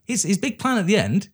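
high-pitched baffled complaint; exasperated. Real, not acted. Co-host ranting about a plot he can't get, exasperated. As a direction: pitch climbing in real exasperation.

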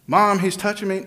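high, loud whiny tattle; whiny tattling little sibling. Retold or imitated. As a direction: whine to mom about your brother. Child tattling to mom.

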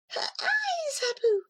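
very high, hushed then calling; urgent high cat cry. Acted. Cat urging Abu about Layla's eyes, high and urgent. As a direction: prompt him quickly.

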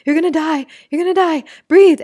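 high, loud frantic alarm; panicking body. Pretend. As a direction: play the body screaming for air. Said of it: "where the body's like".

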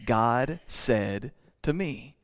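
"God said to me." drawn out very slowly; slow, weighty quote. Retold or imitated. Preacher shows the weight of a prophet's claim, slow. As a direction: let each word of "God said to me" hang. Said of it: "for a man to say".

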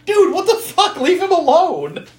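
very high, loud mock outrage; exasperated protest. Telling Fritz to stop teasing the monster, high exasperated outburst. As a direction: squawk it, then stretch the ending. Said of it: "It's like".

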